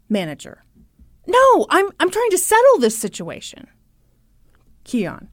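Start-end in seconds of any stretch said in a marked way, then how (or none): 1.3-3.7 higher-pitched, acted-out defensiveness; insistent protest. Manager defending himself to the father, insistent. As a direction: imitate a flustered manager insisting.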